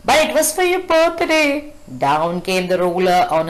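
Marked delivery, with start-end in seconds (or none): 0.1-1.7 put-on much higher, louder pleading little girl; pleading child. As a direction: beg him to understand.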